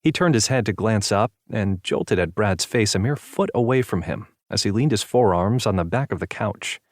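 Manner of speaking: plain reading voice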